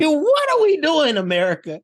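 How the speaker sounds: high, exasperated appeal; incredulous outrage. Real, not acted. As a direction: cry out to the nation in comic disbelief. Reacting to the film's ogling scene.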